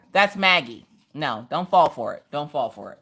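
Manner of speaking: ordinary delivery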